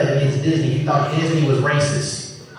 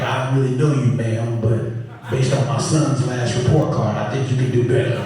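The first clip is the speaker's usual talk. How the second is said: deep stern dad; 7 semitones lower than usual. Retold or imitated. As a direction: drop low and dry as dad. Dad answering girl.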